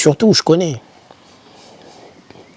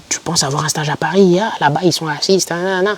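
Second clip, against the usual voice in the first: higher-pitched mimicry of doubters; imitation des sceptiques; 6 semitones higher than usual. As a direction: mimic naysayers, pitch climbing to the end. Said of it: "On me disait". (imitation aiguë de ceux qui découragent)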